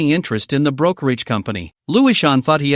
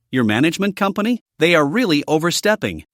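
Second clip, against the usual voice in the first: ordinary delivery, nothing marked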